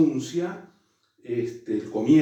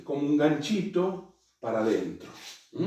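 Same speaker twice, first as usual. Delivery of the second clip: usual speaking voice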